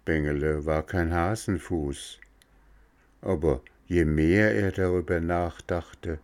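ordinary delivery

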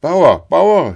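high, loud sentry's shout. A narrator's reading. Sentry hailing the farmer as he passes, shouting. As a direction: yell after someone passing by. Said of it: "schrie der Posten".

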